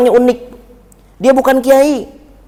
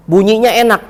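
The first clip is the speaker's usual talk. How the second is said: ordinary talk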